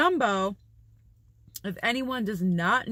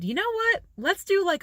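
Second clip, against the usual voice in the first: excited idea; 11 semitones higher than usual. Retold or imitated. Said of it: "and I decided".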